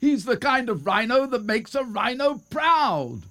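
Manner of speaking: very high, jubilant praise; crowd cheer. A narrator's reading. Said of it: "shouted out the crowd".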